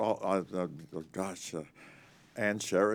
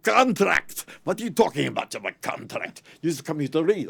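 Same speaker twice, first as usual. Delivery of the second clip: much higher and louder, indignant; outraged director; 9 semitones higher, 10 dB louder than usual. Retold or imitated. Preminger refusing a contract, outraged. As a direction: throw the word back at him.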